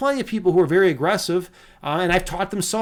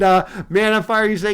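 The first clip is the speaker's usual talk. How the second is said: ordinary talk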